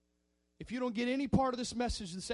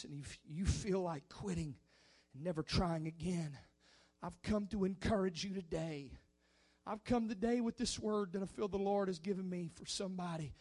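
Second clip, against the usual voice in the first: normal talking voice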